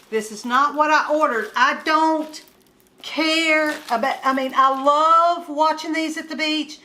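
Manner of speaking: real indignant rant; mad. Real, not acted. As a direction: complain loudly about the wrong order. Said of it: "I'm mad".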